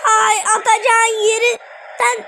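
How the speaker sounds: drawn-out curse. Real, not acted. Cursing missed shot slowly.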